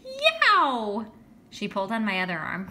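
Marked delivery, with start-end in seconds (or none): 0.0-1.1 very high, loud shriek of delight. Acted. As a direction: shriek like she's seen a marvel. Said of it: "Judy shrieked".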